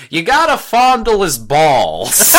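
loud, laughing Jersey advice; New Jersey accent. Retold or imitated. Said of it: "with a New Jersey accent being like".

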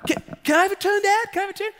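put-on high, stammered kid pleading; imitated frantic child begging; agitated little boy. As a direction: pester Dad like a small child losing patience. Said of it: "starting to get real, like, agitated".

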